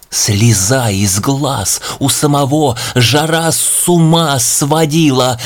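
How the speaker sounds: ordinary delivery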